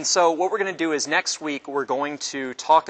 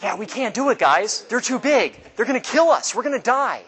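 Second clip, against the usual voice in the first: high, frightened whine of the ten scouts; panicky scouts; 8 semitones higher, 4 dB louder than usual. Retold or imitated. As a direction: pitch way up and panic like a cowardly scout. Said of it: "And the other ten say what?".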